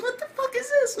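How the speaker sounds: high-pitched incredulous squeal; mock-bewildered high outburst; baffled reaction. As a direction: retell your disbelief in a very high voice. Retells own baffled reaction.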